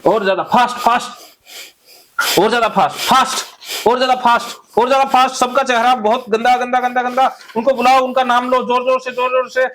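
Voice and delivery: high, driving group-exercise exhortation; fired-up therapy drill leader; urgent cathartic coaching. Acted. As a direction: urge them on relentlessly, pitched well up. Leads cathartic anger exercise.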